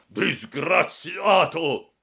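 long, high, furious cry; drawn-out outraged yell; angry shout. A narrator's reading. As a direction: bellow the insult, stretching it out. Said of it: "gridò il signor Clodoveo".

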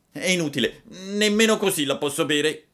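high, loud stubborn refusal; whining puppet. A narrator's reading. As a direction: refuse again like a whiny child. Puppet keeps refusing the medicine. (burattino lagnoso e testardo)

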